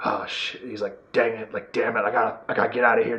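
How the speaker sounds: low, soft imitated grumble under the breath; hushed, low mock exasperation; muttered cursing. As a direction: mimic a hero swearing quietly to himself. Retold Wolverine cursing to himself.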